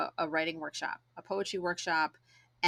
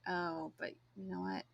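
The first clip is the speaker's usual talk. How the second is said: deflated doubt; 3 semitones lower, 6 dB quieter than usual. Retold or imitated. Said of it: "where you're just like".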